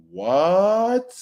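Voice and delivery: long rising, high-pitched "what?"; disbelief. Real, not acted. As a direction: stretch it upward in disbelief. Shocked reaction to the chapter.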